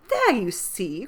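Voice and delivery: delighted "told you so" exclamation; ecstatic. A narrator's reading. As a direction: crow with delight at being proved right. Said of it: "cried Mary in ecstasy".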